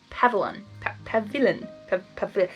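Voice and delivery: plain reading voice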